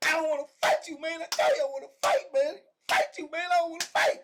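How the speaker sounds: loud, high-pitched mock wail; hollering. Man pinning his bully keeps punching, hollering in protest. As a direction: holler it like a frantic man mid-fight. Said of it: "he hollering he don't wanna fight".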